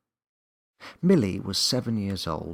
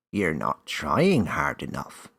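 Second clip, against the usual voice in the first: slow, louder elf voice; little voice exclaiming; 2 semitones lower, 7 dB louder, slower than usual. A narrator's reading. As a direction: tease her from the dark, slowly. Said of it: "the little voice exclaimed".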